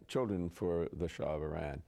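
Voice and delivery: ordinary talk, nothing marked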